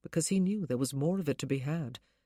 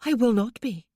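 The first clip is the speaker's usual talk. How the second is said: higher, louder, sure; with conviction; 6 semitones higher, 9 dB louder than usual. Acted. Wife assures husband eagerly, higher and louder. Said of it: "with such conviction".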